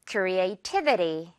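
creativity very slowly, the stress on the TI syllable; slow model word, cre-a-TI-vi-ty. Shown as a demonstration. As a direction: stretch out creativity and punch the TI. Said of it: "T 부분에 강세 주세요".

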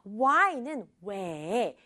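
ordinary talk, nothing marked

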